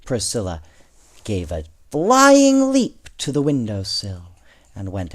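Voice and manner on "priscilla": ordinary delivery, nothing marked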